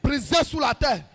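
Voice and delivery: usual speaking voice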